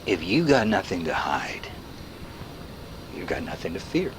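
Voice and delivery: breathy menacing whisper. Acted. Narrator warning the listener in a whisper. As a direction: whisper it like a threat.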